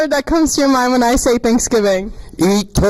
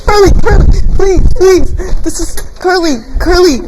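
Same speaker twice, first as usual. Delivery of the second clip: high, loud calling out; 9 semitones higher, 8 dB louder than usual. Real, not acted. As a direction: call out the name across the room. Shouting name to get attention.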